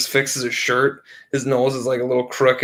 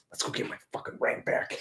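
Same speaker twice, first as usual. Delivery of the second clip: muttered threat; 14 dB quieter than usual. Retold or imitated. John angry, voiced low.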